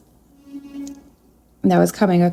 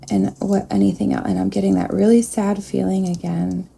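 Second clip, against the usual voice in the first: ordinary delivery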